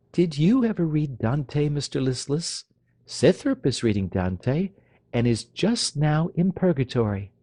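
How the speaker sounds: ordinary delivery, nothing marked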